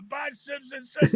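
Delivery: high-pitched mock line call; high impression of a teammate calling out; imitated teammate's call. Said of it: "It was Kendall's buddy next to me. He goes".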